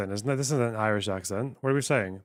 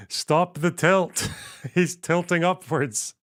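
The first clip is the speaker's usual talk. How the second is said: laughing, higher pitch; real laughing disbelief; 5 semitones higher, 3 dB louder, slower than usual. Real, not acted. Streamer mocking a politician's shoe pictures, laughing. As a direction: crack up while getting the words out.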